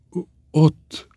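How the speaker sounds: stammered, high-pitched moan; frightened groan. A narrator's reading. Milkmaid in tears pointing at the threshold, groaning. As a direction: groan it out, stumbling on the word. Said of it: "nyögte".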